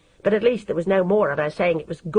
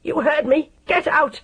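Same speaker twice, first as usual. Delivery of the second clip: shouting mother; 7 semitones higher than usual. A narrator's reading. Mother shouting her away.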